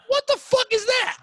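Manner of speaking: indignant outburst. Retold or imitated. Retold indignant reply to barista.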